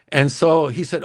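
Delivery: usual speaking voice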